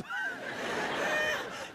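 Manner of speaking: squeaky burst of laughter; high laugh. Real, not acted. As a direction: let out a high giggle. Laugh after punchline.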